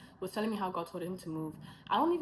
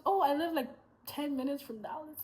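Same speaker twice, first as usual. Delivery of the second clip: higher, cheerful reply; lively girl; 8 semitones higher, 3 dB louder than usual. Retold or imitated. The Texan girl says she lives near Dallas, cheerful. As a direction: say it as a cheerful coincidence.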